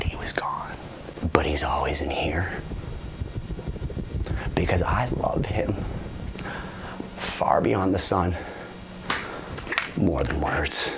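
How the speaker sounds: very low, hushed, lingering tribute; mock-reverent whisper. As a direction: whisper it like a solemn eulogy, pausing between phrases. Mock-reverent hushed ending of story.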